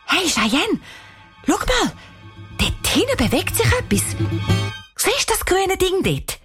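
put-on high-pitched, excited girl's alert. A narrator's reading. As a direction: call your friend over, voice leaping way up. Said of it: "sagt ganz aufgeregt". (ganz aufgeregt, hohe Stimme)

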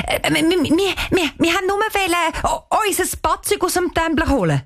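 high, stammering and caught out; stuttering. Acted. Girl caught by the caretaker, stammering an excuse high. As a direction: trip over every word in panic. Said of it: "Danisa stottert".

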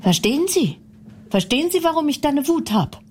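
ordinary talk, nothing marked

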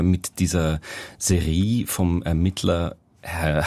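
usual speaking voice

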